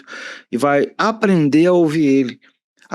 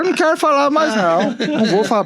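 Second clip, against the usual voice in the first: birra fingida; 11 semitones higher, faster than usual. Retold or imitated. Mock sulking husband refuses to talk.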